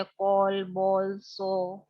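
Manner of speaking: कॉल and बॉल said with a round ओ-like vowel; vowel sound demo. Shown as a demonstration. Teacher to class, sounding out the ओ vowel. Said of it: "ए ओ के रूप में आता है".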